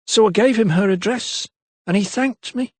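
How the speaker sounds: a lightly voiced London maid, unsure; maid's voice. A narrator's reading. Maid Eliza's voice.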